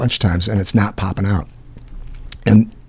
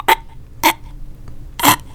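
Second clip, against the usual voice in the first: short choking grunts; separate, cut-off throat catches; imitated choking. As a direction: mimic gagging on something stuck. Imitating choking on stuck candy.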